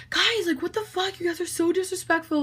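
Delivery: high-pitched imitation of a guy telling friends off; mock indignant scolding. Nolan scolds his friends upstairs, mock indignation. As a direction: scold them in a put-on high voice.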